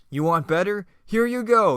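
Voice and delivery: high, loud taunting shout; villain's angry shout. Acted. Villain challenged by the hero starts shooting, shouting angrily.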